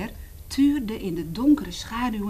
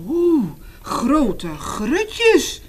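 very slow, higher, louder mock fright; startled elephant; 6 semitones higher, 6 dB louder, much slower than usual. Reading to children, the elephant startled by the warning. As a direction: gasp it out in mock shock. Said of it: "van schrik".

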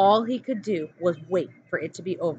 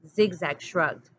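usual speaking voice

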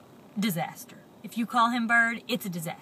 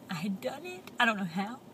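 plain reading voice

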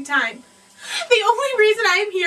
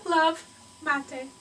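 normal talking voice

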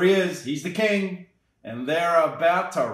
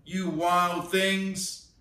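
slow, drawn-out address to the monsters; slow command; 3 semitones higher, much slower than usual. Acted. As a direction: stretch it out, staring them down. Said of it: "Till Max said".

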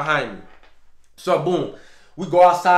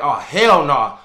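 louder "Oh, hell no", alarmed; sharper exclamation; 8 dB louder, slower than usual. Retold or imitated. Waking in alarm at the door, a loud exclamation. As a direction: blurt it out, now awake. Sees door move at night.

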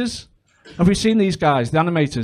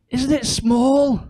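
mock awe; 6 semitones higher, much slower than usual. Family gasping at the cockpit.

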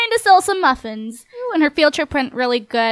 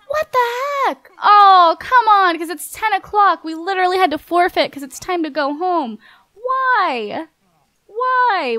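loud and higher, outraged complaint; indignant outcry; 6 semitones higher, 5 dB louder than usual. Real, not acted. Player outraged at the game for ending the contest, loud indignant protest.

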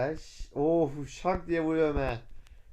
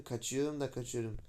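ordinary talk, nothing marked

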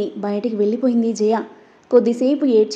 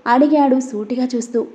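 plain reading voice

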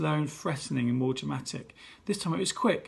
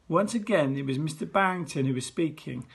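usual speaking voice

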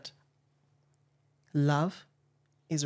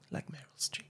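whisper; 11 dB quieter than usual. Real, not acted. Host aside.

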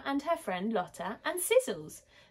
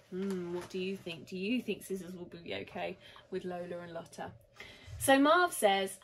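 plain reading voice

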